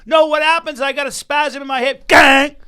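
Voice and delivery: sudden high loud pained cry; yelp of pain. Real, not acted. Cohost yelping at a hip spasm, high and loud in pain.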